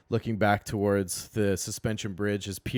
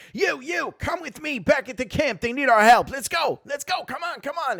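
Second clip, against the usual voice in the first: goblin knight; 8 dB louder than usual. Acted. Goblin knight orders the party.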